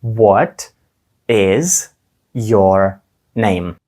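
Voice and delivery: "What", "is", "your name" with pauses between; calmly, word by word with gaps; slow and calm. Shown as a demonstration. Teacher asks learners to say it slowly. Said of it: "спробуємо спокійно, повільно".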